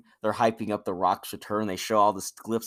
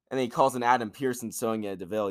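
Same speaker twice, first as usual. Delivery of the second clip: ordinary delivery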